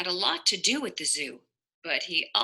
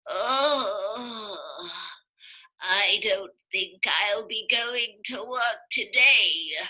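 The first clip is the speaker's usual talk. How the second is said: sick Amos; slower than usual. Acted. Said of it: "and said".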